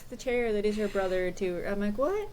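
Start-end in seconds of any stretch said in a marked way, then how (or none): 2.0-2.3 a sharp, much higher rising what; baffled what. Retold or imitated. Reader recalls her bafflement at the chair plot, with a mock baffled what. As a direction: react like the plot just lost you.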